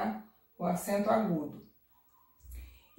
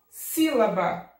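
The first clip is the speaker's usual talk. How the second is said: Sílaba slow, SÍ long and loud; stress on SÍ; 4 dB louder, much slower than usual. Shown as a demonstration. Teacher reading the example, Sílaba with strong SÍ. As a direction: punch the first syllable of Sílaba. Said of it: "a antepenúltima forte".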